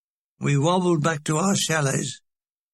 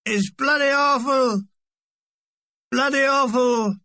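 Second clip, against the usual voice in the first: put-on drunk shouting; 7 semitones higher than usual. Lone drunk heckling the show, shouting. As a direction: bellow it like a heckler in the hall. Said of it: "started to shout out".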